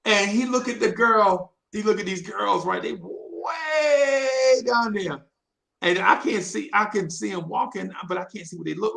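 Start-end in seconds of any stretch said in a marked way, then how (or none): 2.8-5.2 drawn-out distance. Retold or imitated. Showing how far off girls were.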